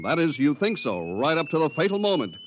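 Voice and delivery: ordinary talk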